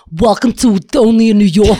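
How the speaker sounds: imitating a co-host's heavy New York accent; exaggerated New York show opener; mock New York accent. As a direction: put on a thick New York intro. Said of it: "do you know why she starts the show".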